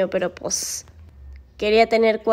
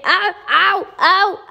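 three high, loud ows; quick high yelps of pain; 7 semitones higher, 5 dB louder, much slower than usual. Real, not acted. Woman nipped by her playful dog, pained yelps.

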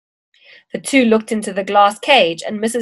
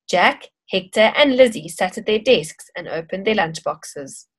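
usual speaking voice

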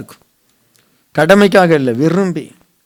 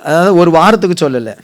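usual speaking voice

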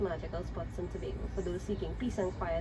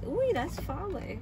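surprised; 10 semitones higher than usual. Real, not acted. Reacting to the distance.

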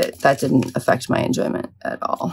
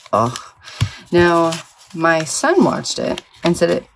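normal talking voice

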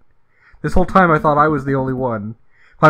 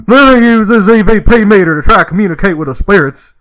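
much higher, much louder hammed-up wild voice; Gary Busey voice; 8 semitones higher, 11 dB louder than usual. Trucker tries the EVP meter, hammed-up loud manic voice. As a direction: shout it like an unhinged celebrity. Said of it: "All right, Gary Busey".

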